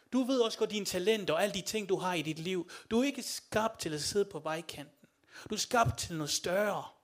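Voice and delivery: loud, rousing sermon exhortation; rally the congregation with loud conviction; full-force preaching. Real, not acted.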